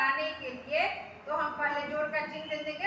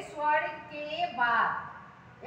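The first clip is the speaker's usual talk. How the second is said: slow dictation; much slower than usual. Shown as a demonstration. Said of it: "समझने के लिए क्या लिखेंगे".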